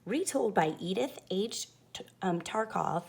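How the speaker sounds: usual speaking voice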